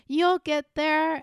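singsong imitation of encouragement; reassuring commenters. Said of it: "people being like".

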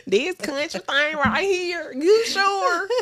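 loud, slow, high-pitched country drawl; overdone country twang. Acted. Podcaster showing off the accent to co-host, overdone country twang. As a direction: ham up the Southern twang far too much. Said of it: "Now you doing a little bit too much".

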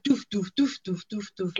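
quick punchy doof noises, fading; imitated thuds. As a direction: pin things to the wall with each doof. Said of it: "she's just like".

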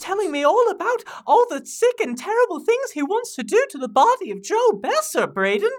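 high, swooping British character voice; gleeful British tattletale; tattling British intern. Acted. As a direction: tell on them in prim, excited outrage. Michael tattles on Mary to Braden.

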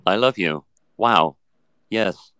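synthetic robot voice; chatbot. Acted. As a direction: speak like a polite, literal machine. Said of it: "run program love".

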